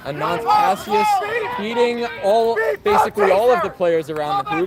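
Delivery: rising excitement on the breakaway; excited commentary. Real, not acted.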